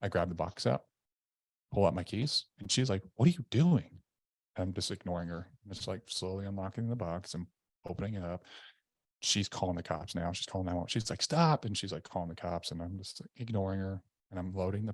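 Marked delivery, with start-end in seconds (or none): 3.2-4.0 retold alarm, high and louder. Wife sees husband unlocking gun box, alarmed retelling. 11.2-11.6 mildly imitated alarm, high-pitched; a quick high plea, lightly re-enacted. Wife tells husband loading pistol to stop, mildly alarmed. Wife pleads as he loads gun.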